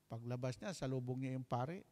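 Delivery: normal talking voice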